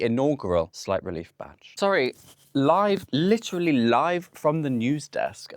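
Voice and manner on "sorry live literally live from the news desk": mock newsreader. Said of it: "That's what I'm calling this".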